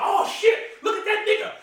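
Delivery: mock surprise. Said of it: "they're like".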